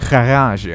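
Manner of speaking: "garage" with a throaty kh at the start; "garage" with guttural first sound and long ah; exaggerated alternative pronunciation. Shown as a demonstration. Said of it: "how do you want to pronounce it".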